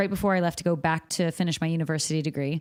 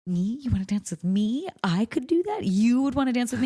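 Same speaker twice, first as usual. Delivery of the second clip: rising, higher-pitched incredulous questions; mock disbelief; 5 semitones higher than usual. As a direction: act out can-this-be-me amazement. Acting out her amazed reaction to offer.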